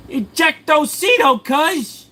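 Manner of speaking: high, loud drawn-out yell; shouted catchphrase. Acted. Streamer yells a catchphrase at chat, high shout. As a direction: holler it way up high, stretching it out.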